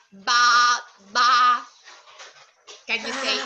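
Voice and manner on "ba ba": loud, level sheep "baa"; sheep bleat. Shown as a demonstration. Teacher showing a child the sheep's sound, bleating. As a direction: bleat two separate loud baas. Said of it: "So the sheep says".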